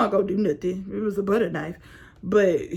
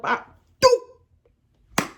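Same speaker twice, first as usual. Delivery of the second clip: throw sound effect; 5 dB louder than usual. Retold or imitated. Throwing the phone.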